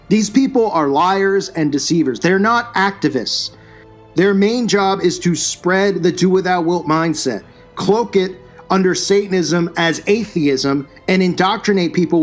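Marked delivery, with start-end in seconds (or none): none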